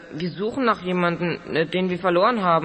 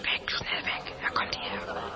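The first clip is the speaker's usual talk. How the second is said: low urgent whisper; 11 semitones lower, 9 dB quieter, slower than usual. Acted. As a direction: hiss the warning softly. Urging escape while hiding.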